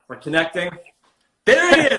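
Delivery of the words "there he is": high, loud joyful announcement; excited. Real, not acted. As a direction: announce the guest with delight. Guest finally connects.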